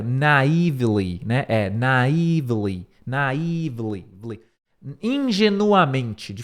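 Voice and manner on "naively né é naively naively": "Naively" slow, NAI-vli; careful pronunciation. Shown as a demonstration. Teacher repeating "naively" slowly for students. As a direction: say "naively" slow, first syllable stressed. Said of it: "tava certo".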